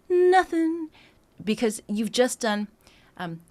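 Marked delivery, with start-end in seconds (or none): 0.1-0.9 "Nothing" high and loud, the N kicked; stress on nothing. Shown as a demonstration. Coach showing how to kick off the phrase. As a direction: hit the N of "Nothing" hard. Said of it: "I would emphasize it like this".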